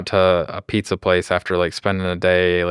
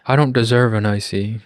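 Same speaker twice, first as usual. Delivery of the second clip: mock sad kid; 4 semitones higher than usual. Voicing the denied kid.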